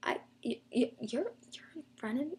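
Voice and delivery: soft, halting re-enacted disbelief; starstruck stammering. Fan facing her idols in the line, starstruck stammer. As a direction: stumble over the words, tongue-tied and quiet. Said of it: "I was just so freaking out".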